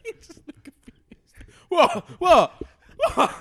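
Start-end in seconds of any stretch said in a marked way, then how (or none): 1.7-3.3 high, loud "What?"; mock bafflement. Mocking his confused friend, loud baffled "What?". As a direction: bark it like a confused lout.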